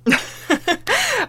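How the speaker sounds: high, short burst of laughter; amused laugh. Acted. Woman laughs about her friend raiding the food, amused.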